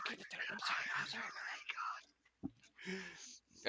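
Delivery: whispered apology. Pretend. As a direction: whisper sorry as if in a library. Mock apology hushed in library.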